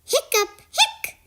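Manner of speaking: squeaky high hiccups. Acted. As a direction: pop two high hiccups. Hiccup sounds.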